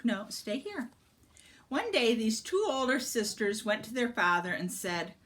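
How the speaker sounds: ordinary delivery, nothing marked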